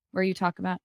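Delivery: normal talking voice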